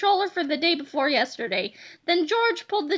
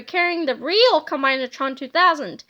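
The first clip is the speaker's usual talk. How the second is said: ordinary delivery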